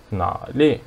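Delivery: nali with a low half third tone on na; low-starting, drawn-out teaching take of nali. Shown as a demonstration. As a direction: keep na low, then let nali rise. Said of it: "This one is half third tone".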